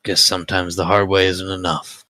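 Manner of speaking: sly gambler character voice; lightly voiced cool gambler.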